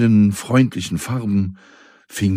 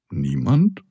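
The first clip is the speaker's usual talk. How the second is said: much higher, rising playful "Niemand?"; questioning surprise; 8 semitones higher than usual. A narrator's reading. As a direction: ask it back with mock surprise. Narrator questions "nobody" teasingly. (verwundert fragend, deutlich höher)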